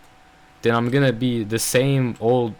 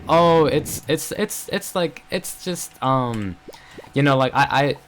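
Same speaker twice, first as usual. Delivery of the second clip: stuttering excuse; 2 semitones higher, slower than usual. Retold or imitated. Friend excusing her silence to the speaker, stuttering. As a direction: stumble over a mock excuse in false starts. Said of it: "I'm stuttering so bad".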